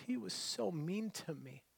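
soft, wounded retold voice; tearful. Upset drive-through worker confiding in a customer, tearful. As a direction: imitate a hurt woman close to tears, quietly. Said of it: "this poor woman is tears in her eyes".

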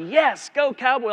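a light fan's whoop; cheering for the Cowboys to match a friend, mock excited. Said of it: "you're like".

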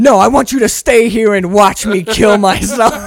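high, loud melodramatic insistence; mock furious rant. Imitating [name] refusing to let [name] go, mock fury. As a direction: demand an audience for your hammed-up despair.

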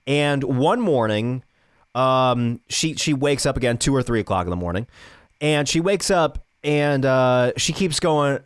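ordinary talk

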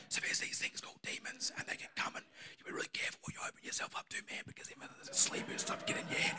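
hushed whisper so the neighbours won't hear. Retold or imitated. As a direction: breathe it out, barely voiced, fast. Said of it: "I'm trying to keep it down".

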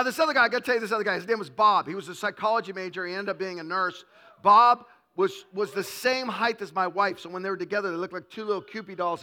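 usual speaking voice

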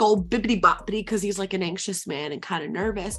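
ordinary talk

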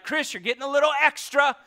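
loud, high, fast mock heckle; mock complaint. Congregant teasing the preacher for being loud, mock shout. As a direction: shout it like a fed-up listener. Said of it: "Sometimes you're like".

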